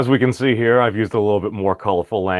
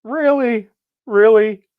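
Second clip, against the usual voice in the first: incredulous; 11 semitones higher, 5 dB louder, slower than usual. Retold or imitated. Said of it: "it's like".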